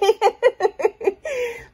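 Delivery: loud high giggle; bright laugh. Real, not acted. Laughing at her own joke.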